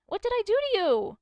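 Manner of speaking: high, loud mock outrage at coworker; exasperated. Streamer vents at the coworker, high and loud. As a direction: plead in frustration.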